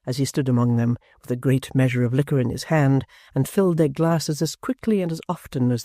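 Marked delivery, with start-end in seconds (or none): none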